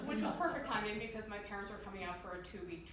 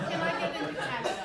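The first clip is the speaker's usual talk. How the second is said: laughing question; 7 semitones higher, 9 dB louder than usual. Retold or imitated. Asking brother, laughing at herself.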